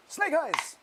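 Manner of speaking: high, loud cry at the dice; excited shout. Real, not acted. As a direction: yell it out at the bad roll. Dice roll comes up badly.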